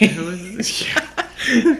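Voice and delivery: giggling through the words; laughing. Real, not acted. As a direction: laugh as you say it. Laughing while answering.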